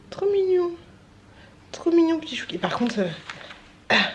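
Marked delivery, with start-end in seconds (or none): 0.1-2.6 cooing. Real, not acted. Cooing over baby photo.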